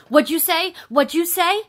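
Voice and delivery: imitating a coworker's loud, high, aggressive challenge; confrontational coworker. Coworker replying to the narrator in the break room, confrontational.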